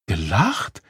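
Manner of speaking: stunned echo with rising end; breathless question. A narrator's reading. Mother learning that onlookers laughed, breathlessly shocked. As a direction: ask it aghast, rising sharply. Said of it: "fragte die Mutter atemlos".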